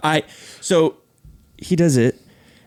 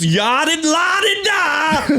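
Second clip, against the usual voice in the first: much higher, slow, sing-song babble; mock airhead; 10 semitones higher, slower than usual. The narrator mocks the driver as a babbling airhead, much higher and slow. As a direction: babble like a space cadet. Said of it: "Like, you're not paying attention".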